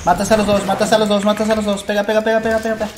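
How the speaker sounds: cada vez más agudo y fuerte, urgente; animando a gritos. Real, not acted. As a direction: empuja al compañero a gritos. Urging teammate during a fight. (gritando para que remate)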